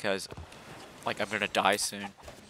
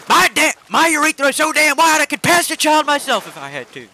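very high, loud cantankerous holler; Cotton Hill; 10 dB louder than usual. Acted. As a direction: yell it as a crotchety old cartoon man. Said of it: "and he's like".

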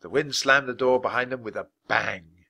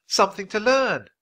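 high, louder excited exclamation; blurting out; 11 semitones higher, 5 dB louder than usual. A narrator's reading. Girl names the discovery to Jack, blurting out. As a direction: finish the outburst high and eager. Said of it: "Annie blurted out".

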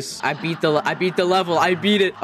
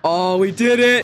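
ordinary talk, nothing marked

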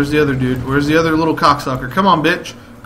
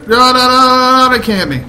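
panicked no's; 9 semitones higher, 8 dB louder than usual. Real, not acted. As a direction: cry out in alarm. Panicked under fire.

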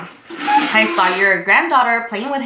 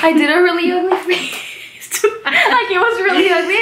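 laughing; 6 semitones higher than usual. Real, not acted. Laughing at ugly face.

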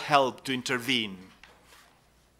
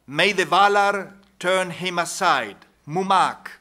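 raised, louder quoted plea; crying out; 4 semitones higher, 8 dB louder than usual. A narrator's reading. Faramir's companion calls on the Valar, crying out. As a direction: cry out, easing on the last word. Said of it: "cried Damrod".

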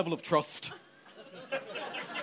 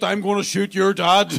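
louder, slow deadpan threat; mock thug threat; 9 dB louder, slower than usual. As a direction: threaten it flatly like a local hard man. Mock threat from back home.

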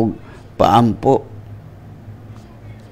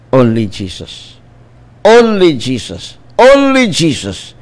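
rising proclamation; slower than usual. Real, not acted. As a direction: climb with each repetition. Repeated preaching climax.

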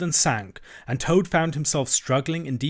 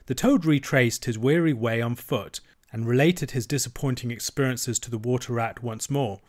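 plain reading voice

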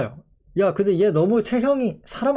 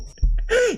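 quick high laugh of disbelief; a brief incredulous chuckle; short laugh; 14 semitones higher than usual. Real, not acted. Streamer mocking a Pokémon's evolution, short high laugh.

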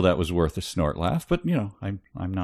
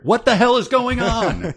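high, loud outraged cry; shocked disbelief; 7 dB louder than usual. Real, not acted. Reacting to absurd poem.

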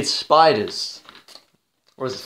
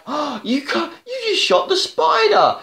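squeaky high mock disbelief; mock doctor; 13 semitones higher than usual. Mimicking the doctor scolding the businessman, high mocking squeal. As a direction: pitch way up in mock shock. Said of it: "the doctor's like".

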